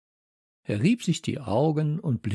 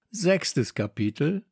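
ordinary delivery

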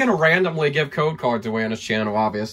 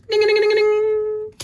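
high sung ding-ding bell; playful high chime. Pretend. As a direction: ring it out like a bell. Chiming like a bell.

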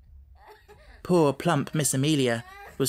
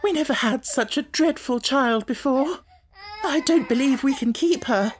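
mild whimper; 11 semitones higher than usual. A narrator's reading. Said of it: "almost whimpered".

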